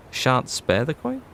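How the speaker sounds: slow, questioning repeat of the odd phrase; puzzled echo. Real, not acted. Streamer echoes the archaic wording, puzzled and slow. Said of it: "I think that means he's gonna be generous".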